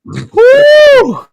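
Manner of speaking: high, loud whoop. Real, not acted. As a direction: let out a big whoop. Whooping at a joke.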